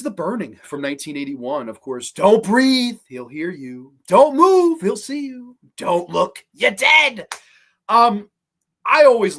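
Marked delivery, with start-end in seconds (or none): 2.1-7.3 louder punchy trailer read, alternating with drops. Acted. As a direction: sell the tagline like a horror movie ad. Recites the movie's trailer tagline.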